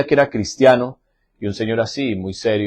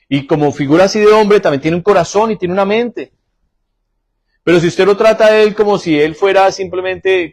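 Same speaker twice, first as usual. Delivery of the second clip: forceful preaching; 7 semitones higher, 5 dB louder than usual. Acted. Sermon rising to full force.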